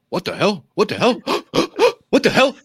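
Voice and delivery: high, rising mock panic with a gasp; cartoonish cop yelping in disbelief; goofy cops. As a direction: ham up bumbling cops freaking out. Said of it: "goofy cops going".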